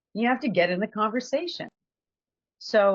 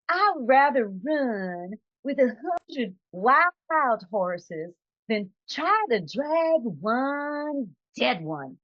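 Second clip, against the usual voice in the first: put-on slow, drawn-out Southern Georgia accent; 3 semitones higher, much slower than usual. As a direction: imitate a Georgia lady's Southern drawl, lightly. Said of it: "I'm gonna try to do her accent".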